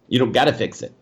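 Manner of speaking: usual speaking voice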